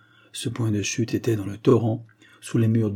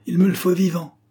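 high, loud, urgent demand; crying out; 8 semitones higher, 5 dB louder than usual. A narrator's reading. Inspector wanting the spy taken alive, crying out. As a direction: shout the order over the danger. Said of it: "lui cria Paul Bard".

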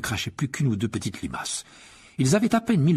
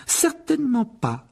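much higher, crisp stern teacher; McGonagall; 13 semitones higher, slower than usual. A narrator's reading. McGonagall catching the boys in the hall, stern and high. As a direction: address them like a strict professor.

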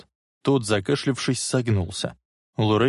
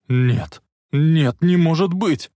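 anguished denial; 7 semitones higher, 5 dB louder, slower than usual. Acted. Marty at his father's grave, anguished denial. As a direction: cry rising high and loud.

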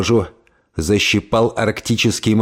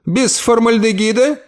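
high rising on формальдегида; lightly voiced aunt, suspicious; questioning old lady; 10 semitones higher than usual. Aunt questions, Carrington answers.

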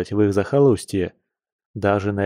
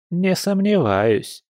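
dismissive; 8 semitones higher, slower than usual. Acted. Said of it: "отмахнулась бабуля".

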